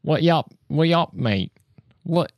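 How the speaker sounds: rough imitation British lad accent. Shown as a demonstration. Showing what dropped letters turn into, British lad accent. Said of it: "what kind of British that is".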